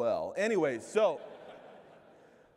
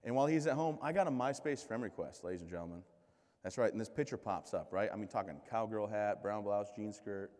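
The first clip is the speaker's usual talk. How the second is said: ordinary delivery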